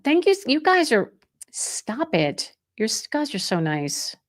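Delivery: embarrassed by compliments; flattered. Real, not acted. Realtor flattered by kind viewers, bashful.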